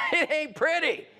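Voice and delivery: high laugh then words through it. Real, not acted. Speaker laughing at his crash image. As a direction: chuckle and say it laughing.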